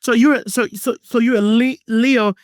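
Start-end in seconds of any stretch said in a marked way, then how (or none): none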